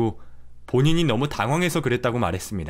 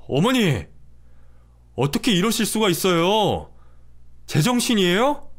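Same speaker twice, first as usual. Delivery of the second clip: higher, indignant husband shouting at his mother; outraged son; 7 semitones higher than usual. Acted. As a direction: confront your mother in shock. (어머니에게 따지는 화난 남편)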